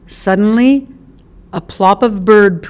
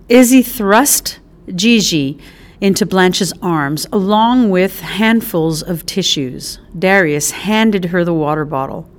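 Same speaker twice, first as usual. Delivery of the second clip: ordinary delivery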